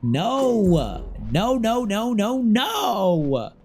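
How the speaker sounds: high, stretched string of noes; exaggerated refusal. Real, not acted. Streamer rejecting the word pop, whining refusal. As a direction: wail each no in mock dismay.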